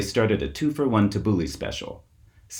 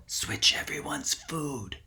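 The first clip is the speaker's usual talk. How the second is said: slow, hushed whisper, much softer; 7 semitones higher, 8 dB quieter, slower than usual. A narrator's reading. Kip secretly instructs his friends in the dark, whispering. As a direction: breathe it slowly so nobody else hears. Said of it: "He whispered to Sabri and Yael".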